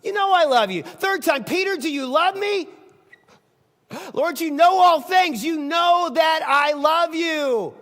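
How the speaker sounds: loud, high, stretched out; shouting his love, slowing at the end; impassioned cry. Acted. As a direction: plead with all his heart. Peter's impassioned answer, shouted.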